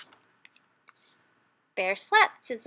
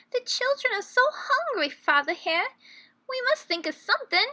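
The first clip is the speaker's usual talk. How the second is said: high, pleading mother's voice, rushed at the end; a worried wife begging her husband; urgent plea from a hungry family's mother; pleading Missus Hare; 10 semitones higher than usual. A narrator's reading. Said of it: "Missus Hare cried one day".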